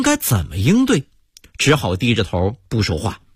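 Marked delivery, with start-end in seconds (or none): none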